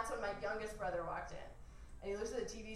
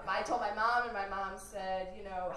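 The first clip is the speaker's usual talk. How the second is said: ordinary delivery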